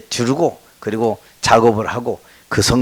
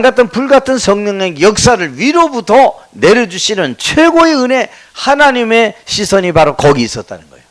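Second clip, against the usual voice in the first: raised, emphatic sermon delivery; full-force preaching; 8 semitones higher, 5 dB louder than usual. Real, not acted. Preacher assuring congregation that God watches their gathering, impassioned preaching. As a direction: preach it high and forceful, driving each point home.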